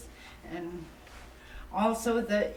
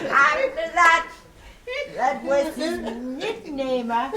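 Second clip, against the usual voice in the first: talking through real laughter; can't stop laughing while telling it; 6 semitones higher, 8 dB louder than usual. Real, not acted. Telling relatives the nickname story, laughing through it. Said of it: "laughing while telling".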